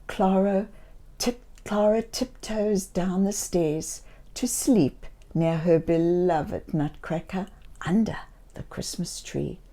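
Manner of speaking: put-on soft, tiptoe hush for a sneaking child; quiet bedtime-story hush; hushed storytelling. Tiptoeing scene read softly.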